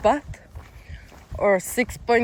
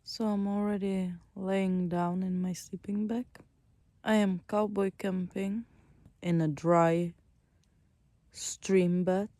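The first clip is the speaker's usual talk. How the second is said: soft night-time whisper; hushed whisper in a sleeping bag; 8 dB quieter than usual. Real, not acted.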